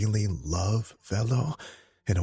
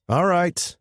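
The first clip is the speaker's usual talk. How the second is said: high, loud cocky younger brother; Enzo's voice; 13 semitones higher, 7 dB louder than usual. Acted. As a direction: pitch him up high and loud, brash. Enzo, the youngest brother, speaking.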